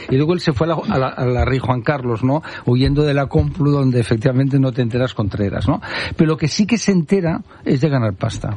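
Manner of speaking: ordinary delivery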